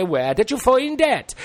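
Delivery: surprised parent. Acted. Parent voiced in a story.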